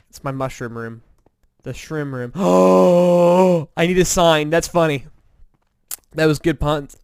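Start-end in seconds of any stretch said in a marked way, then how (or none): 2.4-3.4 loud sudden gasp; excited gasp. Real, not acted. Excited by the shroom room idea. 3.4-6.9 excited. Real, not acted. Excited by sign idea.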